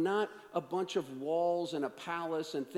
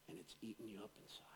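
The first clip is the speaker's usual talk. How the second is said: fast, breathy whisper; 10 semitones lower, 21 dB quieter, faster than usual. Real, not acted. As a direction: lean in and whisper it. Intimate whispered aside.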